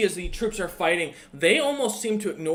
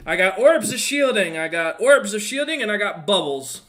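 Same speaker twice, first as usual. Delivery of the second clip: loud market-stall cry, higher pitched; hawking merchant; 5 semitones higher, 8 dB louder than usual. Acted. Merchant hawking orbs and bubbles, loud market cry. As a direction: shout your wares like a street hawker. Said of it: "He goes".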